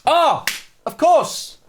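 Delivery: exaggerated SpongeBob narrator flourish; French narrator. Streamer trying the SpongeBob French narrator, hammed-up. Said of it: "French narrator from SpongeBob".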